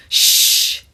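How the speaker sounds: sharp unvoiced hush; shush. A narrator's reading. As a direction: moviegoer shushing the noisy tooth. Shushing in cinema.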